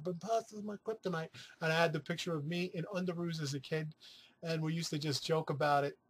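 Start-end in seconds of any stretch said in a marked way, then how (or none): 0.0-1.3 lightly put-on mom voice, softer; a hint of an imitated mom; pretending to be Mom. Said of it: "used to pretend to be Mom".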